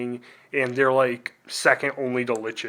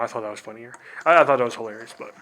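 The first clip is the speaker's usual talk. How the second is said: ordinary talk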